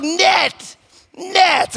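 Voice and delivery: high-pitched mock shout; imitated kid yell. Dad imitates daughter yelling at the dog, high mock yell. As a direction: yell it like a little girl at a dog. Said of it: "yelling at the dog".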